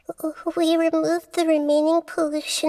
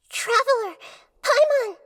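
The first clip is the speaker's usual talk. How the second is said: urgent call, rising higher; 5 semitones higher than usual. Acted. Calling to her companions who lie asleep, urgent and raised. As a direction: call their names as if they might not answer.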